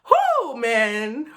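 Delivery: loud tearful whoo; catching breath after crying; shaky exhale. Real, not acted. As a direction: blow out the emotion. Recovering from tears.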